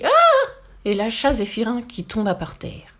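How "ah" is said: very high, loud scream; shriek of pain. A narrator's reading. Witch bitten on her wart, screaming. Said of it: "La sorcière poussa un cri".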